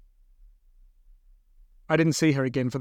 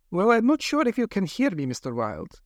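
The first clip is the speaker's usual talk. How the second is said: lightly voiced brisk scientist; a hint of a cool, reasoning doctor; 7 semitones higher than usual.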